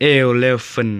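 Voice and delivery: "eleven" with an open middle and weak "-fen" ending; correct pronunciation. Shown as a demonstration. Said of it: "มันบ่แม่น eleven".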